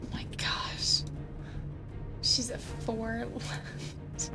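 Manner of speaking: ordinary talk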